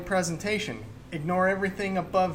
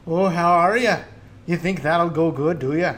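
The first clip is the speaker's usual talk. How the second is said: louder Scottish brogue, teasing; Scottish accent; 2 semitones higher, 7 dB louder than usual. Retold or imitated. Podcaster quoting a movie, in a louder Scottish accent. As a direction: ask it in a thick Scottish accent. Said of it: "doing this Scottish accent".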